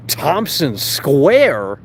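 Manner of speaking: high, slow, loud incredulous question; drawn-out disbelief. Real, not acted. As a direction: stretch the name out in disbelief. Repeats the name in disbelief.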